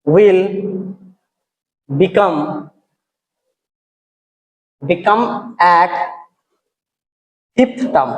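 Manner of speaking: word by word, long pauses; halting, spaced-out classroom dictation; slow dictation. Real, not acted. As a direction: dictate for students writing along. Dictating the answer to write.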